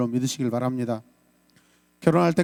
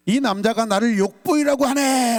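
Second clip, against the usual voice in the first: raised-voice cry for help; demo outcry; 7 semitones higher than usual. Shown as a demonstration. Pastor shows congregation the required outcry, raised and high. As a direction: call out high to be heard. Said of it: "라고 큰 소리 질러야 돼요".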